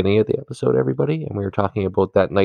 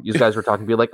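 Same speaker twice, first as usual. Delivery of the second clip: ordinary talk, nothing marked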